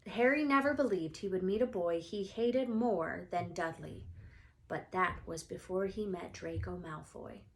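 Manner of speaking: usual speaking voice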